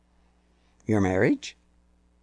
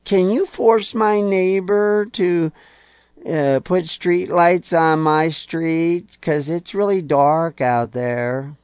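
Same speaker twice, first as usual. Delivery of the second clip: higher, slow, wheedling ask; mock pleading request; 5 semitones higher, 4 dB louder, slower than usual. To his congregation, mimicking a citizen begging officials, higher and slowly. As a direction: play a needy voter begging the state for favors. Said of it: "and said".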